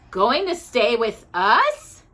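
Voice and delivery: slow disbelieving question, rising at the end; incredulous. A narrator's reading. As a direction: repeat the news as if you can't believe it. Mrs Bird repeats in disbelief.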